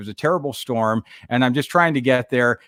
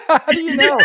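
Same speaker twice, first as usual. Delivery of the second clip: laughing; 13 semitones higher, 3 dB louder than usual. Retold or imitated. Laughing surprise at recognition.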